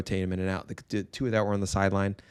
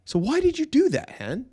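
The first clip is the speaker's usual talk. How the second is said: much higher-pitched dad asking why; exasperated parent question. Retold or imitated. Dad asks his son why he did that, exasperated. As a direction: press the child for a reason, pitch leaping up.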